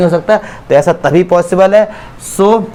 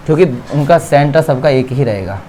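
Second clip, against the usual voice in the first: ordinary talk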